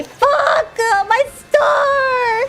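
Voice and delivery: gamer rage. Real, not acted. Streamer yelling at losing his star. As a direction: yell at the bad luck. Raging at a game loss.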